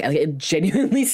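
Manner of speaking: normal talking voice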